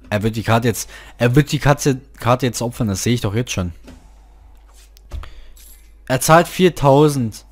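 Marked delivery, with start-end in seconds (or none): none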